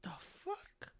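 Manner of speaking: hushed, whispered shock; quiet stunned disbelief. Retold or imitated. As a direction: breathe it out softly, jaw dropped. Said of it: "I was quietly jaw open, like".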